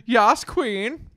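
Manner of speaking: high, loud sassy cheer; campy cheer. Acted. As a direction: hype them up like a drag queen.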